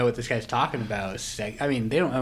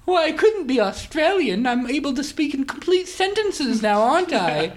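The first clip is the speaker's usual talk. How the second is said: higher, louder hammed-up posh English gent; posh English accent; 13 semitones higher, 6 dB louder than usual. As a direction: play the Monopoly tycoon in a plummy English accent. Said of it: "Are you English or Australian?".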